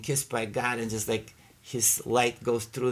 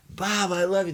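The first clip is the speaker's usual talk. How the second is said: higher, louder gush of love; overflowing love; 8 semitones higher, 4 dB louder than usual. Retold or imitated. Telling his friend Bob of his love, joyful gush. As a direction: blurt it out full of joy. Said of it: "I'm going".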